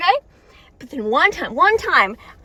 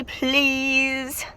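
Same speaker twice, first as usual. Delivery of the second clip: usual speaking voice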